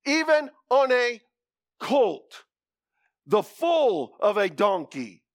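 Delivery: slow, emphatic scripture reading; slow solemn reading of the prophecy. Acted. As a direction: proclaim each phrase.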